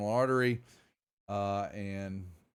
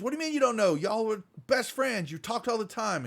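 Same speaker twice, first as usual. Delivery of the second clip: angry Reed; 11 semitones higher, 4 dB louder than usual. Acted. Reed presses Flynn.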